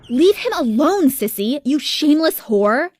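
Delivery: loud, high angry outburst; freaked-out scream. A narrator's reading. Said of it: "I freaked out, screaming".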